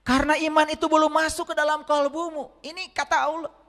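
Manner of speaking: high, loud sermon voice; fiery preaching. Acted. Preacher rousing the congregation, loud and high. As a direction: preach it out to the whole hall.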